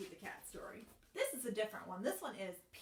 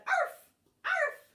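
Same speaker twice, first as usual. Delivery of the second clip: high, loud yappy barks; dog barking; 12 dB louder than usual. Acted. Teacher barking as the dog in the song. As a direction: bark like a little dog. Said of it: "And the dog said".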